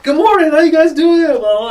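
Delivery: very high, loud bubbly greeting; overly cheery. Retold or imitated. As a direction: greet strangers with giddy enthusiasm.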